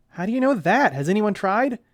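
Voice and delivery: loud, high challenging question; defiant hero. Acted. Neil challenging the villain's claim, loud and defiant. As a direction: call his bluff.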